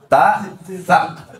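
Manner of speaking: "Ta" plain, then "tha" with the tongue pressed up; Arabic ta versus tha. Shown as a demonstration. Teacher to congregation, contrasting ta and tha, tongue pressed up. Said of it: "tha itu ditekan lidah ke atas".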